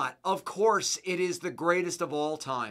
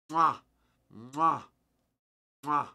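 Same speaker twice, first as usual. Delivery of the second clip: blown kisses; slower than usual. Real, not acted. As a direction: blow kisses goodbye. Kissing goodbye to the departed cat.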